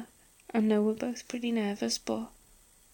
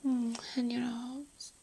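soft intimate whisper; breathy close-mic whisper; 4 dB quieter, slower than usual. Acted. Whispered intimate roleplay throughout.

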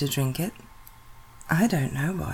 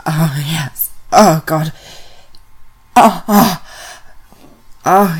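moans growing sharply louder; 11 dB louder than usual. Acted. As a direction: let the moans swell quickly. Rising moans during the act.

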